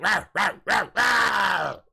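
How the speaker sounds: loud, rising zombie roar; zombie screech. Retold or imitated. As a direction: shout it like a game zombie. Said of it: "Call of Duty zombie noises".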